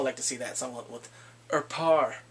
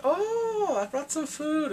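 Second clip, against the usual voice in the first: high, loud, bright fake-casual voice; intruding mom; 12 semitones higher, 4 dB louder, much slower than usual. Mom barging in on the couple, chirpy high interruption. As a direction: break up the moment with a chirpy, much higher voice. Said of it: "The mom walks in like".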